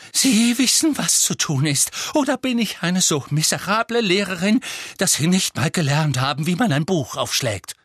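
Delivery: high and loud, spitting out sarcasm; an offended teacher lashing out; loud, high, hysterical. A narrator's reading. Trelawney mocks the class, loud, high and hysterical. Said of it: "mit lauter, hoher und leicht hysterischer Stimme".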